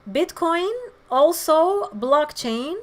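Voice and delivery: ordinary delivery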